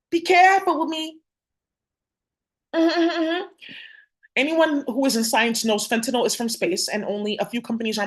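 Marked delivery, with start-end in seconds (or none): 0.1-1.2 higher, louder, taunting threat; menacing warning. Real, not acted. Warning the accused brother, pitched up and taunting. 2.7-3.4 high sing-song mm-hmm taunt; mocking hum. Real, not acted. Taunting the accused man with a high mocking hum.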